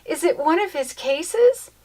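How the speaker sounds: excited exclaim. Acted. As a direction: ask breathless with hope. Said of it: "she exclaimed".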